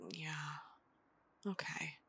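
soft breathy whisper. Acted. After the kiss, whispering to the friend. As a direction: breathe it close.

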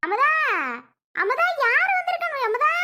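high, ringing call to someone in the house. Acted. Mother-in-law calls Amutha to greet the guests, ringing call. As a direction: sing out her name across the house.

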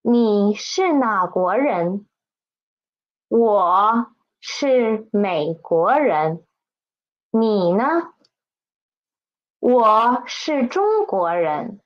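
teacher reading the text out slowly to copy; slow model reading. Shown as a demonstration. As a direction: read each line slowly and carefully for learners. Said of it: "I'm going to read it and please listen carefully".

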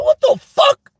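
very high shrieking shout; panicked yell. Retold or imitated. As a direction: shriek it, spotting who hit the car. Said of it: "I said".

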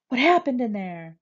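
urgent shouted question; yelled demand. A narrator's reading. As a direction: demand an answer at a yell. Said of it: "The man on the phone yells".

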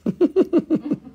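high-pitched run of laughter; real laugh. Real, not acted. Seller amused during the live sale, real high laugh. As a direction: let out a high, pulsing laugh.

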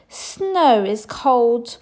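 slow, loud, sniffly whine; sniffing. Acted. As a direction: sniff it out, freezing. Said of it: "she sniffed".

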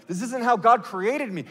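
normal talking voice

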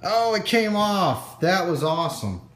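thrilled it finally worked; excited. Real, not acted. Stuck piece finally comes free.

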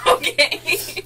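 laughing through the word; loud laughing okay. Real, not acted. Laughing fit after joke.

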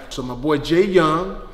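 ordinary talk

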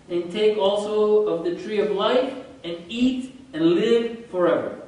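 slow, loud, weighty scripture recital; solemn scripture reading. Acted. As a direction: proclaim God's words from the pulpit. Reads Genesis verses aloud.

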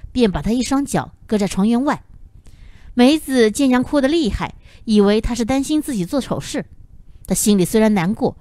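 ordinary delivery, nothing marked

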